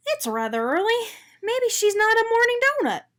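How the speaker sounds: a chirpy little donut musing; Arnie the donut. A narrator's reading. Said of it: "Arnie supposed".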